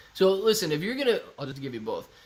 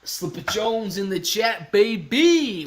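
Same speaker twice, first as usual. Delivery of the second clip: slow, high announcement building louder; hyped shout-out; 5 semitones higher, 5 dB louder, slower than usual. Real, not acted. Streamer hailing a chatter, hyped shout-out. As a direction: stretch it out and finish loud.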